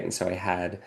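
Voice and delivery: ordinary talk, nothing marked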